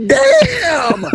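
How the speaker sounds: high, drawn-out cry of amazement; stunned reaction. Retold or imitated. Retold reaction to an insult.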